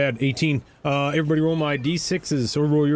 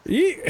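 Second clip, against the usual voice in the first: burst of laughter; 10 semitones higher than usual. Real, not acted. GM laughs at player's joke.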